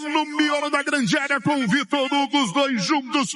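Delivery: excited radio play-by-play; explosive goal commentary. Acted.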